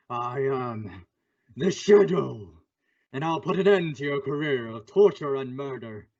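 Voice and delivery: echoing, disembodied menace; hollow filtered voice to the killer; ghostly Shadow voice. Acted. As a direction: speak as an invisible avenger.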